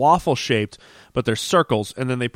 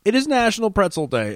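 much higher, bright and proud; excited announcement; 9 semitones higher than usual. Acted. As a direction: proclaim it like a holiday.

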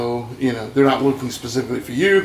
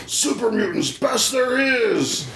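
slow, high monster battle cry; Super Mutant shout; 10 semitones higher, slower than usual. Retold or imitated. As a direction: bellow it like a brute mutant. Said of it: "hearing the dialogue from the Super Mutants".

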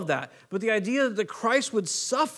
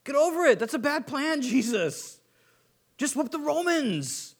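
high, scoffing disciples brushing off the plan; protesting disciples; 6 semitones higher than usual. Pretend. Disciples scoffing at Jesus's plan, high and dismissive. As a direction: talk him out of it, higher and mocking.

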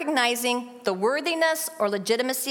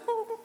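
shivery ooh; 11 semitones higher, 7 dB quieter than usual. Shown as a demonstration. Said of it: "you can just go".

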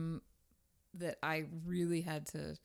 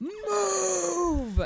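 loud, high, drawn-out yell; long scream; 13 semitones higher, 10 dB louder than usual. Retold or imitated. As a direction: re-enact a long road-rage scream, stretching the vowel. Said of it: "just screamed a long".

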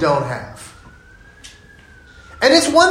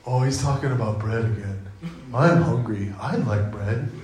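much lower, soft, dim-witted mumble; dopey disciples; 8 semitones lower, 7 dB quieter than usual. Retold or imitated. Disciples misunderstanding Jesus, lower and dopey. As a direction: play them as simple and only hungry. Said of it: "And the disciples go".

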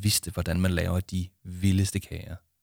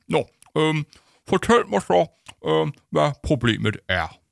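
awkward teacher stepping in, pitched up and loud; halting, raised-voice mediator; hesitant teacher; 8 semitones higher, 5 dB louder than usual. Acted. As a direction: fumble loudly for the diplomatic question.

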